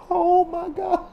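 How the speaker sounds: very high, thrilled squeal; overjoyed squeal. Retold or imitated. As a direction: squeal it in happy disbelief. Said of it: "I was so happy to see this".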